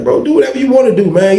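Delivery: high-pitched, put-upon retort; exasperated retort. Retold or imitated. Telling Leroy to do as he likes, high exasperated retort. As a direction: throw it up high, mock exasperation. Exasperated reply to cellmate's plan.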